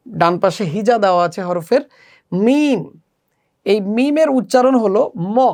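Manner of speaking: normal talking voice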